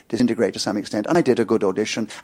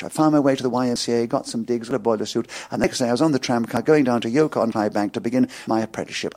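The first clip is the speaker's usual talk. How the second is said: ordinary talk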